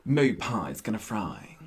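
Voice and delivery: low, slow deadpan imitation; quoted sitcom character. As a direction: drop the pitch and draw the line out. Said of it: "she just says".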